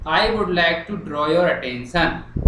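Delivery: dragged-out slow English line; slow dictation. Shown as a demonstration. Teacher reading the letter's opening line aloud, very slow. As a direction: say it slowly so they can write. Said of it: "ये देखो".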